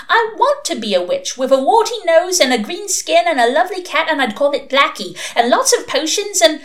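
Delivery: put-on high-pitched, excited small-child voice; eager little girl. A narrator's reading. Six-year-old suspect telling inquisitors her witch dreams, eager. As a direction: gush like a child listing wishes.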